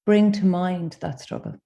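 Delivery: gentle guided meditation with long pauses; unhurried invitations, each left to settle in silence. Acted. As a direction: guide them inward, phrase by phrase.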